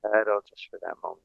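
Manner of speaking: very soft. Real, not acted. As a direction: drop almost to a murmur. Very soft guided instruction.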